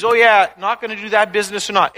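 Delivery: loud, offhand mock voice of the fire; a careless fire's loud mock aside; mock picky fire. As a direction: play the fire shrugging off a choice, loudly. Fire mockingly voiced as choosing.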